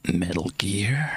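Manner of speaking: husky whispered soldier voice; low gruff stealthy whisper; David Hayter Snake impression. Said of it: "my David Hayter Snake impression".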